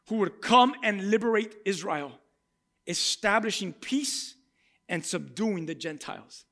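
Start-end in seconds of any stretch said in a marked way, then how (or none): none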